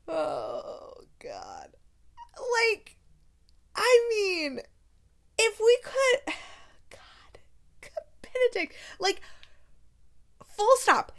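nearly weeping over the line; overwhelmed swoon. Real, not acted. Vlogger overwhelmed by Benedict's line, tearful. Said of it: "overwhelmed by the romance".

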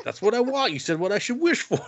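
high-pitched whiny self-defence; imitating an indignant wisher; mock defensive protest. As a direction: protest high and hurt, hammed up. Hero defends his wish.

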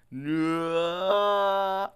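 long, loud, high drawn-out wail; hammed-up movie scream stretched out; mock-agonized cry, held long; slow-motion mock scream. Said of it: "he was screaming in slow motion".

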